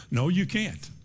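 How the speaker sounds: normal talking voice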